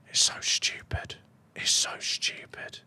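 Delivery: breathy whisper under the breath. Real, not acted. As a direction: whisper it like a secret annoyance. Annoyed muttering at the board.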